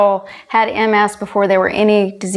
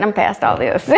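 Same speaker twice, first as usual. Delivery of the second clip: laughing it off; 12 semitones higher than usual. Real, not acted. Laughing off tears to host.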